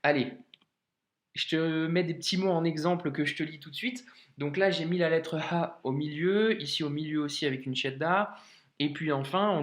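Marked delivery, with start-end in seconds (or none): none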